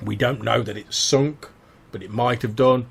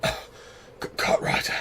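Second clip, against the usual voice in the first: whispered gasp; 5 dB quieter, much slower than usual. Acted. Half-drowned survivor gasps a name.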